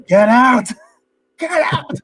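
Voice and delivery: high, loud imitated yell of get out. Leo screaming at Bob to get out, loud mock yell. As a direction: shriek get out as he loses it. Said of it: "And he loses it with him".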